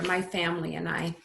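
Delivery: usual speaking voice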